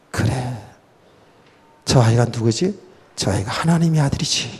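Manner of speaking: soft, low talking to oneself; musing. Retold or imitated. Mary reminds herself whose son he is, musing. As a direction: drop low and quiet, reasoning it out alone. Voicing Mary's private realization.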